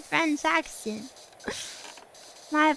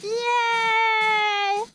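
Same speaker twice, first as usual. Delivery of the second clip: excited yay; 5 semitones higher, 5 dB louder than usual. Real, not acted. Delighted reaction in game.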